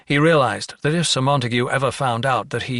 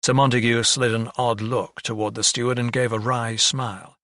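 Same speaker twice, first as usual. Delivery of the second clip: usual speaking voice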